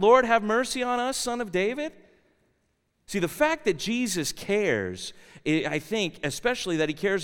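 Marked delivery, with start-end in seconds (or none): 0.0-1.9 higher, raised narrated plea; calling out, easing off at the end; crying out. A narrator's reading. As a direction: quote the blind men's cry for mercy. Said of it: "Two blind men crying out".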